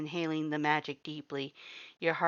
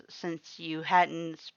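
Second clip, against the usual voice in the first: ordinary delivery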